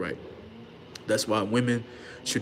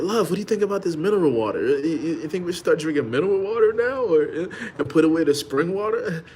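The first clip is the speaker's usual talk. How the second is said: eager husband; 6 semitones higher than usual. Retold or imitated. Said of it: "He like".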